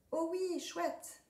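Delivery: very high, thrilled squeal; tiny ant overjoyed; delighted ant. A narrator's reading. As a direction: squeak with joy.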